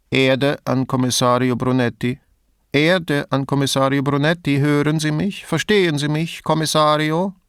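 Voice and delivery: mock extraterrestrial voice, held high and even; alien voice. A narrator's reading. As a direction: hail someone from outer space like an alien. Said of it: "mit der Stimme eines Aliens".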